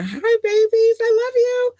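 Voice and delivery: very high, cutesy greeting; high sweet squeal to the fans; squeaky baby voice. Acted. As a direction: coo it like a little cartoon girl.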